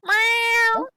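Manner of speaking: very high mock meow; cat meow. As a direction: squeak it out like a little cat. Player voices the fire cat.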